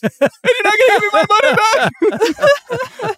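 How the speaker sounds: high, loud flustered shout; frantic Seinfeld-style complaint. Retold or imitated. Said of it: "my flustered voice".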